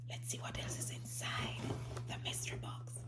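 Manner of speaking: quick, hushed whisper; conspiratorial whisper, a little fast. Acted. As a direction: whisper the mystery box like a secret. Whispered peek into the box.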